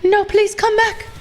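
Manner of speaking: high, pleading mock panic; mock scared pleading. Streamer begging the lost high range to return, mock scared pleading. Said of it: "I was getting really scared".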